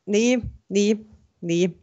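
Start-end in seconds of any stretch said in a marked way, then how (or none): none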